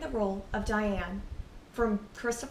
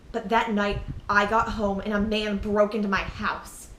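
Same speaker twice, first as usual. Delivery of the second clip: loud, forceful dramatic confession; anguished disclosure; 5 dB louder than usual. Acted. Telling sister of that night's attack, anguished and loud. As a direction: force it out, voice raised in distress.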